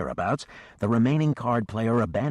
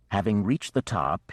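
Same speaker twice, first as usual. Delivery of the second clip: ordinary delivery, nothing marked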